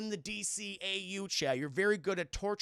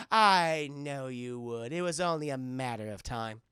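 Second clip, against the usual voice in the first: gloating villain; 5 semitones lower, 5 dB louder than usual. Acted.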